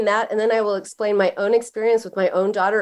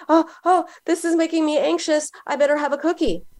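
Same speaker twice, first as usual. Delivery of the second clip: anxious woman; 7 semitones higher than usual. Retold or imitated. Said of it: "the woman who says".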